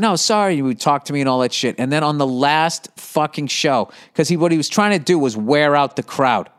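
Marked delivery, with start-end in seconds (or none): 0.0-0.5 mock apology. Comic mocks the opener who kept overrunning his time, apologizing falsely. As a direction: toss off a fake sorry. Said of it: "he just kept saying".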